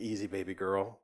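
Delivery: low, soft imitation of a calming man; quiet, low-pitched reassurance, acted out; imitated soothing interpreter. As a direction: gently settle her down, voice dropped low. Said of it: "this is how he said it".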